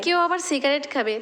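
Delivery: usual speaking voice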